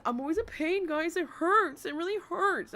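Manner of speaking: high, whiny imitation of her; a hammed-up whine; mock whining. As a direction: whine it like she does. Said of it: "you always constantly say".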